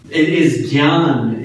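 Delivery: normal talking voice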